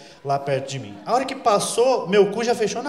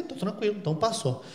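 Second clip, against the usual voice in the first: much softer, to himself; relieved under breath; 10 dB quieter than usual. Retold or imitated. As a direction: mutter relief quietly. Alívio sussurrado após policial passar. (bem baixinho, aliviado)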